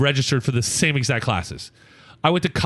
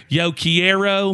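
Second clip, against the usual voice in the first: put-on slow, higher American-accented Spanish; mom's bad Spanish; 6 semitones higher, much slower than usual. Mom repeating in language lab, slow American-accented Spanish. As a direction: imitate mom labouring through Spanish. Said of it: "I can hear my mom".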